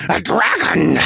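high, loud breathy creature shriek; hiss-scream it with lots of air. A narrator's reading. Small creature spots a dragon, breathy hissing scream. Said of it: "hissed in a breathy scream".

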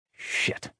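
breathy under-breath swear; whispered curse. Acted. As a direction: whisper it to yourself. Muttered inner curse.

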